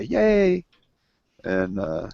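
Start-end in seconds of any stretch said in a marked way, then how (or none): 0.0-0.6 sarcastic yay. Pretend. Mocking a dull evening plan.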